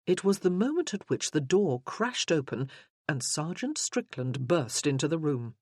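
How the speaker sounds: ordinary delivery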